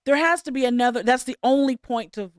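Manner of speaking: normal talking voice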